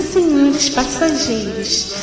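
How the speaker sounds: ordinary talk, nothing marked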